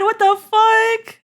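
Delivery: high, slow, drawn-out groan of disbelief; dismayed disbelief. Real, not acted. To Chancey about the missed meeting, high drawn-out dismay. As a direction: stretch it out in mock despair.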